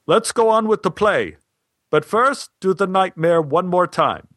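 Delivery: high-pitched call to the stage; retold call, pitch easing down at the end. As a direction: imitate a director calling instructions. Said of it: "Stein called from the back of the theater".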